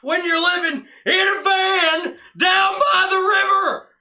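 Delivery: full-blast high, drawn-out bellow; huge shouted comic impression; Chris Farley. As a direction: holler like an unhinged sketch motivational speaker. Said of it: "If anybody needs a Chris Farley impression".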